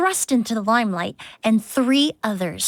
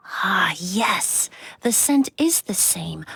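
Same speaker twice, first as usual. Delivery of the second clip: whisper; 4 semitones lower, 3 dB quieter, much slower than usual. Acted. Sniffing and analysing the bottle.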